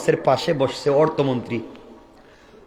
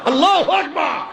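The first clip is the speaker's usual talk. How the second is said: exclaimed takbir; 12 semitones higher than usual. Acted. Minister seeing clearly praises Allah, high cry. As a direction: burst out with praise, high and ringing.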